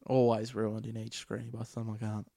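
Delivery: normal talking voice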